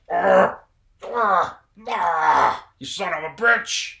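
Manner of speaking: high, loud raspy roaring growl; Nick Nolte roar. Retold or imitated. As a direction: roar it like a gruff cop. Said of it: "Nick Nolte's main thing is to be like".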